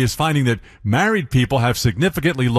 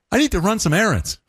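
higher sing-song about errands; baby talk on human phrases; 8 semitones higher than usual. Shown as a demonstration. Host trying baby talk on an everyday sentence, higher sing-song. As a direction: sing a dull errand to a dog. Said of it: "I'm terrible at this".

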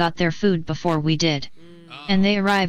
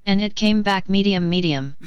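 robot voice. Acted. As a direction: speak like a polite bot. AI Karen bot voice.

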